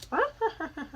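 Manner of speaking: shrill witchy cackle; high cackle. Real, not acted. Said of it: "You sound like a fucking witch".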